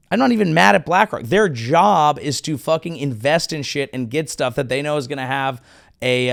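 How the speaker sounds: ordinary talk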